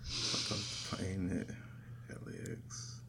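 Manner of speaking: slow rhythmless whisper of the lyric. Real, not acted. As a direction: whisper it to yourself. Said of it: "this is so terrible without rhythm".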